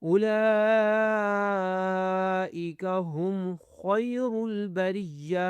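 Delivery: خیر with a thick khaa and ra; slow tajweed chant of خیر البریہ; heavy khaa recitation. Shown as a demonstration. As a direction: hold اولئک very long, chanting slowly. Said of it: "اب خا کو موٹا کرنا ہے".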